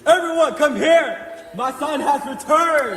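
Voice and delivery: high, loud call to the household; joyful calling out. Acted. Father shouting to the household that his son is back. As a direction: call everyone in, overjoyed. Father calls everyone to celebrate.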